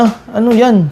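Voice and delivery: high, puzzled "ano yan"; surprised. Retold or imitated. Cyclist hears the price, sounds baffled and surprised. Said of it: "takang-taka pa siya".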